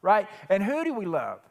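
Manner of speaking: preaching hard and loud, building passion; worked up preaching. Real, not acted. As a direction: let the sermon climb, pushing every line. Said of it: "I know I'm getting worked up".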